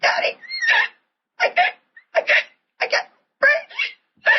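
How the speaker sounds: put-on high, breathless little boy choking out words; son gasping for air. Seven-year-old son crying to his daddy, gasping and breathless. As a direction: play the child struggling to breathe, voice thinning out. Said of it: "it was so dramatic".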